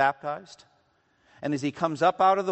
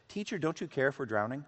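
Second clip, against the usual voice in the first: soft, quick, restrained plea; understated preaching delivery; 7 dB quieter, faster than usual. Shown as a demonstration. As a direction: play the panic down, quietly and quickly. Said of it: "I'll just preach it like this".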